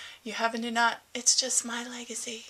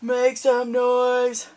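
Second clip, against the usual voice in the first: louder, slow stadium chant; slow loud call; 4 semitones higher, 9 dB louder, much slower than usual. Shown as a demonstration. Vlogger reads towel slogan, slow and loud. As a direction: call it out like a ballpark announcer.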